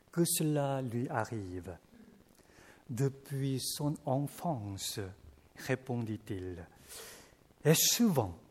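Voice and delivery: measured, solemn Bible reading; scripture reading. Acted. Preacher reads the Gospel to the congregation, solemnly. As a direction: read the passage like a lector.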